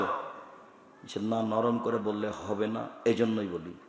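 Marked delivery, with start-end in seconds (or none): 1.2-3.7 low, soft and steady answer; calm reply. Retold or imitated. Preacher answering the shouting stranger, quiet and calm. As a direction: settle him down quietly. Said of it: "তখন আমি বলছি".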